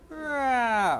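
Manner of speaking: put-on sudden high yelp of a hurt child; cry of pain. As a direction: let out a short pained wail. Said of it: "the natural response is".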